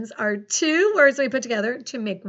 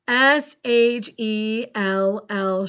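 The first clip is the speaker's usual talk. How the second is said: slow, letter by letter; measured, separated letters; spelling aloud; 4 dB louder, much slower than usual. Shown as a demonstration. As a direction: spell it out slowly for a class. Teacher spelling a word aloud.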